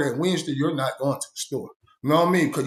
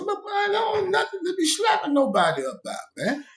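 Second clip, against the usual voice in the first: high shouted disbelief; incredulous outcry. Retold or imitated. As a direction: shout it way up, appalled. Reacting to the slapping.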